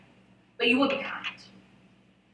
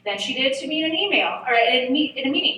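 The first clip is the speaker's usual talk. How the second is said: ordinary talk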